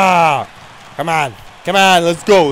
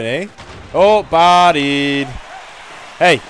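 normal talking voice

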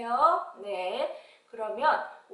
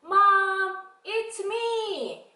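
high, stretched singsong plea; child calling Mom; 10 semitones higher, 8 dB louder, much slower than usual. Acted. Child at the door asking mom, high singsong plea. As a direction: call out and beg to be let in. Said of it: "친구들이 뭐라 해요".

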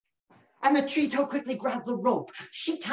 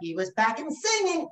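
usual speaking voice

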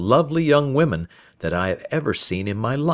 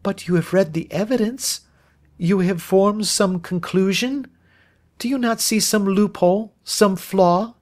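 put-on high, pleading young woman's voice; urgent, imploring questions; anxious Miss Turner; 12 semitones higher than usual. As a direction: press hopefully for agreement.

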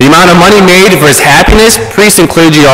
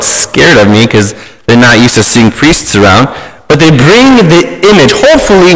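ordinary talk, nothing marked

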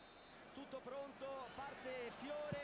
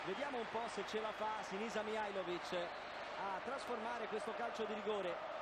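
ordinary talk

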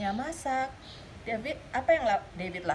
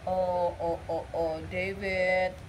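lower, sorry-for-him sighs; a hint of pity, lower; mock sad; 6 semitones lower, much slower than usual. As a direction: sigh over the sad boy. Sad David goes to room.

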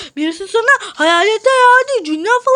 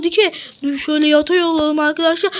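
normal talking voice

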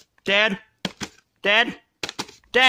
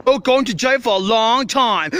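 normal talking voice

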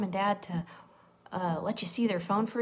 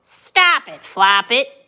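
loud, lightly voiced hen's warning; lightly voiced scolding hen; 15 dB louder, much slower than usual. A narrator's reading. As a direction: punch out a storybook scolding. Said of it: "Chicken said".

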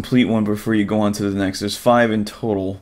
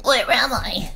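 high, softer, timid little creature voice; nervous squeaky creature; 13 semitones higher, 4 dB quieter, slower than usual. Acted. Blue creature introduces itself to Bubble, high and timid.

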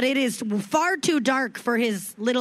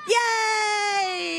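excited cheer; 7 semitones higher than usual. Real, not acted.

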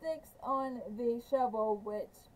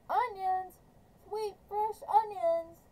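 street vendor calling; 7 semitones higher than usual. A narrator's reading. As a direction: call out your onions across the lake. Sam calling out selling onions.

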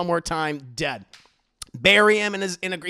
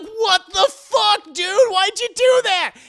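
much higher, louder outraged cry; outraged boy; 10 dB louder, slower than usual. Retold or imitated. JoJo after the dog kick, high outraged cry. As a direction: shriek at him in disbelief.

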